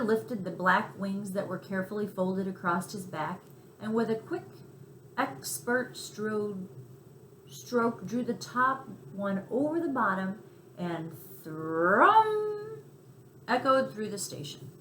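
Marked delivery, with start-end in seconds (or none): none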